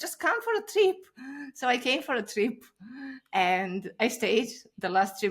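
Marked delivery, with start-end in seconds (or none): 0.1-1.0 coaxing friend. Retold or imitated. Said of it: "He's like".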